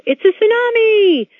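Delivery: high, loud, drawn-out mock warning cry; cartoon cry of alarm. Imitating a cartoon character spotting a big wave, high cried-out alarm. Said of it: "Someone in the cartoon cried out".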